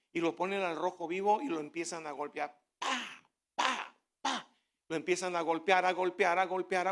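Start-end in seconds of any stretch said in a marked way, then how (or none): 2.8-4.5 sharp high "¡Pa!" clangs, like a smith striking; hammer blows. Retold or imitated. Preacher imitating the blacksmith striking hot metal. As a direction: punch out each hammer hit.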